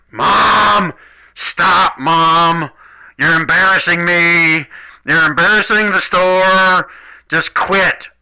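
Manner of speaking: loud, whiny yell at mom from the back room; teen child yelling at mom in the store, loud and whiny; embarrassed kid yelling. Acted. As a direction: shout like a mortified teenager.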